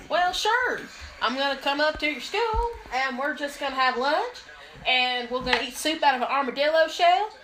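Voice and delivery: hammed-up Southern country accent; folksy cowgirl twang, playing it for laughs. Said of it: "Be, uh, cowgirl".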